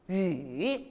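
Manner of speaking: 유 dropped low, then lifting; third tone. Shown as a demonstration. Teacher models third tone for students, 유 dipping low. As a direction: dip 유 down and up. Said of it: "삼성으로 해보세요".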